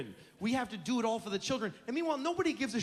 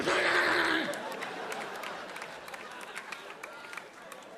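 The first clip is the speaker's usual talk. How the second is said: put-on kid's tantrum wail; 11 semitones higher than usual. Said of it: "they go".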